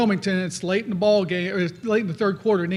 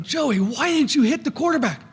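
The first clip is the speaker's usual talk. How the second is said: exasperated coach; 6 semitones higher than usual. Retold or imitated. Coach confronts player off field.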